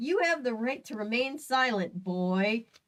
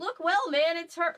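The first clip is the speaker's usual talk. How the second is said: pitched-up character line; high-pitched take; 6 semitones higher than usual. Acted. Reader trying out the officer's line, high-pitched.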